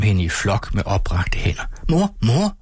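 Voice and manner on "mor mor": high excited call; children calling. A narrator's reading. Children calling their mother at the tree, high and excited. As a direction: call out like eager kids. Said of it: "råbte de".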